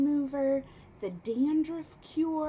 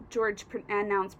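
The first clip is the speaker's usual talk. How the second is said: usual speaking voice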